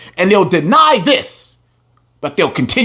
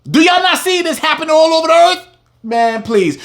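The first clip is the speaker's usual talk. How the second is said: ordinary delivery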